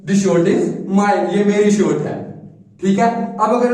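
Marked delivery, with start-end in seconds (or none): 0.1-1.4 very slow, clear model sentence; slow example sentence. Shown as a demonstration. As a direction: dictate it slowly for the class. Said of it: "तो मैं क्या बोलूंगा उसको?". (धीरे धीरे साफ़ बोला गया उदाहरण)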